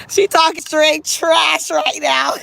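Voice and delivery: slow, high, drawn-out through laughter; laughing exclamation. Real, not acted. Telling viewers about her friend's trash talk, laughing. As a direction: crow it, cracking up.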